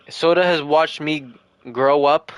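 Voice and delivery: ordinary delivery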